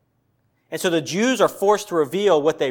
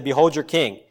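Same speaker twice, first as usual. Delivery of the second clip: ordinary delivery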